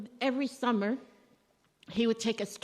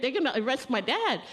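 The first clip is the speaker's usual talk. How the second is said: ordinary talk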